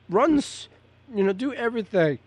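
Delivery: talking through hiccups; words broken by a hiccup. Real, not acted. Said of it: "I got the hiccups here".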